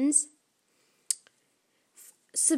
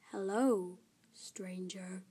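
low, soft and eerie; creepy voice; 5 semitones lower, 10 dB quieter, slower than usual. Acted. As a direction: whisper it from the shadows. Creepy hidden voice.

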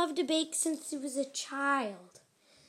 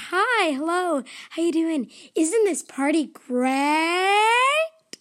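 cheerful loud greeting; 3 semitones higher, 7 dB louder, slower than usual. Acted. Cheerful baker greets guests.

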